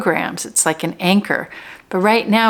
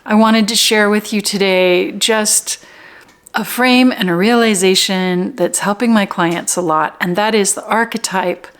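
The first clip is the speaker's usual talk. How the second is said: ordinary delivery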